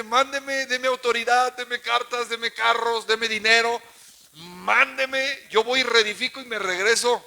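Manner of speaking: loud, pleading preacher's voice as Nehemiah; loud plea to the king. Acted. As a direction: beg the king at full volume.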